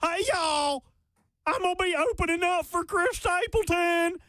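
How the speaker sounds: high, hammed-up Southern twang; mocking country-singer voice. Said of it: "I'm [name], y'all".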